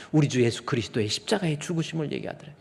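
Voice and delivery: usual speaking voice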